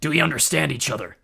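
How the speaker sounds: loud menacing growl; growling threat. A narrator's reading. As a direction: growl the warning. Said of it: "Ares growled".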